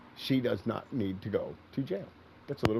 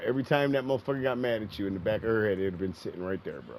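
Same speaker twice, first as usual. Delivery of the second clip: usual speaking voice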